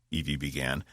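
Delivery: plain reading voice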